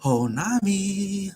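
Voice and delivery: very high, slow lovestruck sigh of her name; Sanji swooning. Retold or imitated. Retelling, Sanji arrives swooning over Nami, very high and slow. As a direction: croon her name like a smitten knight. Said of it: "you hear".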